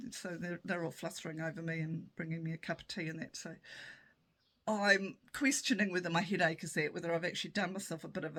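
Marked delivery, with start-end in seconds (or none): none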